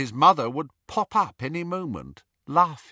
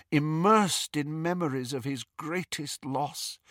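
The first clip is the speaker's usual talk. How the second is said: usual speaking voice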